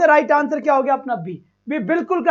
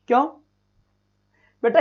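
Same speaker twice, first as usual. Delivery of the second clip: ordinary delivery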